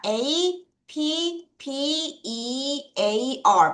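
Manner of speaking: "A P P E A R" letter by letter, each one stretched; spelled out slowly. Shown as a demonstration. Teacher spells the first chunk for pupils, slow separate letters "A P P E A R". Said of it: "여기까지 끊어서".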